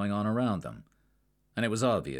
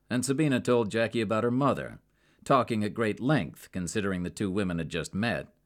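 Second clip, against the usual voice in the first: ordinary delivery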